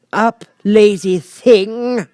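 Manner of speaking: high, loud, slow wake-up order; scolding queen. A narrator's reading. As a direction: snap the lazy creature awake. Queen wakes the griffin.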